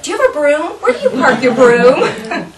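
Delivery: high, loud imitated children, laughing; kids teasing. Kids quizzing teacher next day about broom, imitated high.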